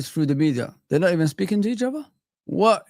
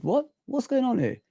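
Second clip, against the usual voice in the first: lightly voiced, higher, puzzled player; mock confused Van Dijk; 7 semitones higher, 5 dB quieter than usual. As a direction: act like he just woke up. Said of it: "Van Dijk said".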